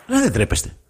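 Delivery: high, scornful ρε; mock disgust; exasperated scolding. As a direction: scold grown men for play-acting. Scolding the footballers.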